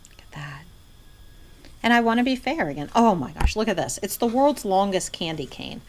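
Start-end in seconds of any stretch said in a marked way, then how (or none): 0.1-0.6 barely voiced admiration; hushed whisper. Real, not acted. Showing viewers an ornament, in a hushed whisper. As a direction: say it under your breath.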